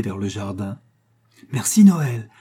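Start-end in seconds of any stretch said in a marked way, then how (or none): 1.5-2.3 a higher, louder burst of thanks; joyful child's thanks. A narrator's reading. Little boy thanking Father Christmas at the window, loud and joyful. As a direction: call your thanks out the window. Child blowing kisses to garden.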